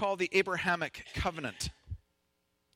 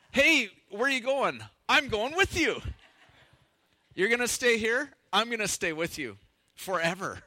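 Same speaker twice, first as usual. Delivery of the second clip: mock daughter-in-law chat; 4 semitones higher, 3 dB louder than usual. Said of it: "I'm gonna try it".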